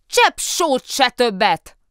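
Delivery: slow, high, loud shout; shouted back. A narrator's reading. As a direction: yell each word back at him. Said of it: "kiáltotta vissza Kóbor Muki".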